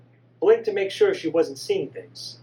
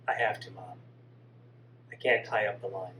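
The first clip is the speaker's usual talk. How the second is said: breathy whisper, lower, fading away; whispered goodbye; 9 semitones lower, 6 dB quieter than usual. Acted.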